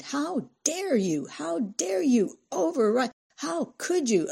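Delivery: high, drawn-out indignant scream; slow, pitch-soaring fury; screaming outrage. A narrator's reading. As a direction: stretch each word in shrill outrage. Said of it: "Cindy screamed".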